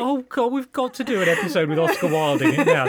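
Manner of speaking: exasperated laugh; laughing exasperation. Retold or imitated. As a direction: groan it out while laughing. Retold groaning reaction, laughing.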